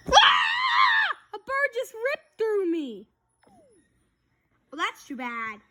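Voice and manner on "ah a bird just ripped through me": high pained yelp; panicked scream. Acted. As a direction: cry out as the bird tears through. Bird rips through him.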